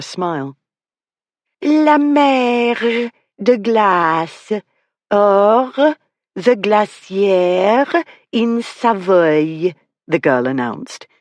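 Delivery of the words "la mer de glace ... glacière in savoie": very slow, higher mock French accent with thick throaty R's; overdone French accent. Girl announcing the next view to the room, in an overdone French accent. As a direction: lay on the French like a stage guide. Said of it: "in an overdone French accent".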